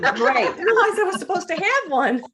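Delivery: high, bright giggle through the words; laughing. Real, not acted. Author laughing to hosts about her borrowed tiara, giggling.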